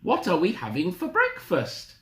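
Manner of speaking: put-on high-pitched old-lady question; eager Granny. Granny asking about breakfast, high and eager. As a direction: ask in a high, expectant Granny voice.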